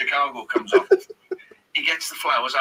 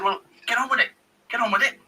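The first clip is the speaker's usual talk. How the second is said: high, insistent manager's order; Clough impression; 8 semitones higher, faster than usual. Manager ordering Arch to do the deal, impatient. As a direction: imitate an impatient gaffer, pitched up.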